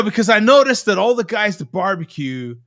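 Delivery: ordinary delivery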